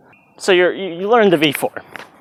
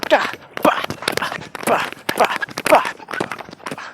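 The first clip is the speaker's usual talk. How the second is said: airy, high whispered rhythm; light whispered step beats; 11 semitones higher than usual. Shown as a demonstration. Teacher demonstrating light footwork with whispered beats. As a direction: breeze through the beats lightly. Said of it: "It feels great".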